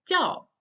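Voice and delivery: "叫" falling sharply; fourth tone. Shown as a demonstration. Teacher shows the verb, "叫" sharply falling. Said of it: "the fourth tone".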